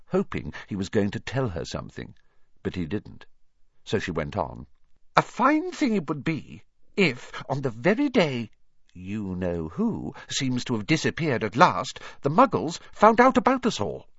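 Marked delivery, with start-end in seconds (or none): 5.1-14.1 high, fussy professor's voice; disapproving professor. Acted.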